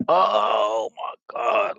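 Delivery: slow, drawn-out awe, a lightly voiced inner gasp; awed oh my God. Overwhelmed by multidimensional visions, slow mild awe. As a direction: stretch it out in mild amazement. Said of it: "being me, it's like".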